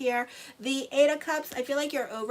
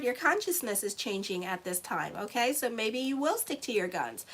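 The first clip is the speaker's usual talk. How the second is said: usual speaking voice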